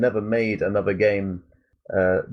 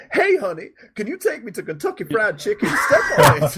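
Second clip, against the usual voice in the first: much higher put-on woman's voice, ending in a shout; 11 semitones higher, 3 dB louder than usual. Voicing the game's pregnant passenger, high and ending in a shout. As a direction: play the pushy cab passenger, then yell step on it.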